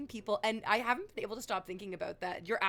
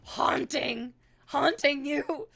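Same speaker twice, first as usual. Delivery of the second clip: louder melodramatic ghost-story voice; mock dramatic horror; 3 semitones higher, 9 dB louder than usual. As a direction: ham up the lurking dangers. Mock horror about the outside world.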